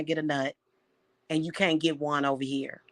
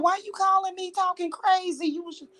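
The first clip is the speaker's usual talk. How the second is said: higher, incredulous retold reply; mock exasperated self; 13 semitones higher than usual. Telling Mike what she said, higher incredulous reply. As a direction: act out your own disbelief on the call, pitched up. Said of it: "I was like".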